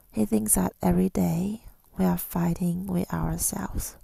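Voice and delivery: ordinary delivery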